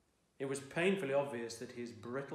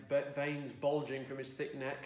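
ordinary delivery

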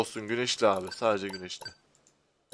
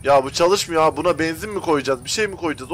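ordinary talk, nothing marked

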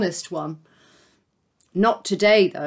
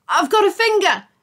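high, louder sudden blurt; 9 semitones higher, 6 dB louder than usual. Acted. Jake panicking on the phone, blurted out. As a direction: blurt it out before thinking. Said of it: "he blurted".

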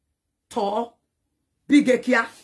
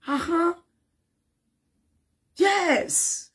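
ordinary talk

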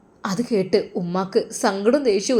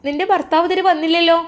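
higher, brighter caring nurse; nurse's voice; 8 semitones higher, 5 dB louder, faster than usual. Acted. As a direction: ask the patient kindly, voice lifted. Nurse asks about husband. (പരിചരിക്കുന്ന നഴ്സിന്റെ ഉയർന്ന ശബ്ദം)

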